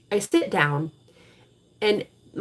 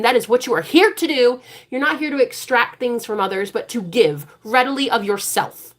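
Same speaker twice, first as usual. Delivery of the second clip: higher, louder preacher's cadence; preaching; 5 semitones higher, 3 dB louder than usual. Acted. Tarot reader preaching to viewers about serving God, fervent. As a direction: preach it like from the pulpit.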